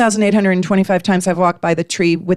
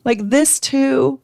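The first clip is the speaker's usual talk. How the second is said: exasperated disbelief; 7 semitones higher, slower than usual. Retold or imitated. Walking the sick dog in the rain, exasperated high cry. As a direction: throw it up to the sky, fed up. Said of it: "But I'm like".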